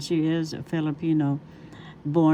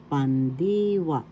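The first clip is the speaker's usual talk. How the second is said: pandiwa with a long, raised DI; stress on second syllable; slower than usual. Shown as a demonstration. Teacher demonstrating a Tagalog word, stress on pandiwa's second syllable. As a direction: stretch the middle of pandiwa, pan-DEE-wa. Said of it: "The accent is in the second syllable".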